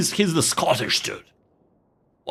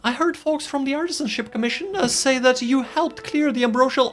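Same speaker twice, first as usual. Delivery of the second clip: voiced game character; 14 semitones higher than usual. Reads character dialogue.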